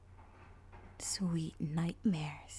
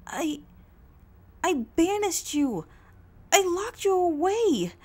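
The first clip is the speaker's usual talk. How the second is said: much higher and louder panicked questioning; frantic distress; 10 semitones higher, 14 dB louder than usual. Acted. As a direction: demand answers in rising alarm. Heroine confronts escaped villain.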